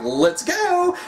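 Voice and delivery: high, eager mock rallying shout; eager battle cry. A rival rushing into battle, high eager shout. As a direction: pitch it up high like a fighter itching to charge in. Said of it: "Vegeta are like".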